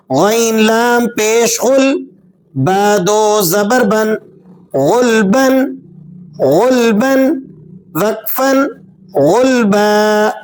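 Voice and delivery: غلبن with a thick, full-mouthed غ; full heavy غ. Shown as a demonstration. Teacher showing the heavy غ in غلبن. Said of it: "غین کو پر کر کے بھی ادا کریں گے".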